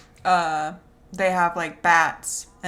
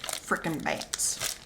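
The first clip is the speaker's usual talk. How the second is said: whisper; 2 semitones lower, 8 dB quieter, much slower than usual. Pretend. Previewing the whispered bats story.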